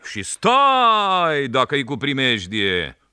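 high, loud, slow call; boy performing the rafters' calls for the policeman; raftsmen's signal call. Shown as a demonstration. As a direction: cry it down the river. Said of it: "așa fluierăm noi, plutașe".

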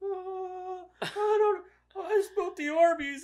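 high-pitched mock-crying child; imitating bawling kid. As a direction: whimper out a confession like a little boy. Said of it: "he's already bawling".

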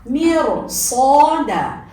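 Miro with a full thick r; thick, emphatic ra in Mirsada. Shown as a demonstration. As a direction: say Miro, sada with a heavy, full-mouthed ra. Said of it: "Kalın okunuyor".

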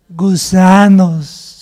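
gusanos stretched very slow, low and loud; drawn-out gusanos. Acted. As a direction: let gusanos hang, slow and heavy. Dramatic climax of worm story.

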